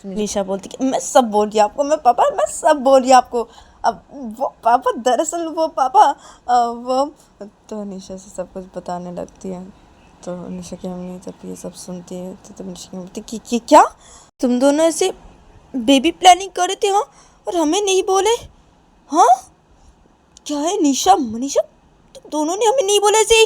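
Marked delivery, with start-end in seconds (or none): 0.8-7.0 loud, higher, stumbling over the start; flustered explaining. Acted. Nisha tries to explain to her father, flustered. 13.5-23.5 very loud, high-pitched scolding questions; indignant outrage. Acted. Mother confronts both for not telling her, indignant.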